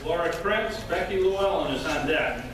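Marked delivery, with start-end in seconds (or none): none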